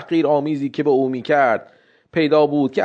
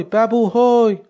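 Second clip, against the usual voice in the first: calling again higher and louder across the yard; 8 semitones higher, slower than usual. Acted. Old woman calls her son again from the doorway, calling louder. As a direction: shout the name, pushing it further. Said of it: "داد زد".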